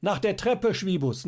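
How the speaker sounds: high, loud call with stress on Treppe; shouting up. A narrator's reading. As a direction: yell up to the window, Treppe rising. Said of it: "schrie ihm jetzt Schierling zu". (lauter Ruf, Betonung auf Treppe)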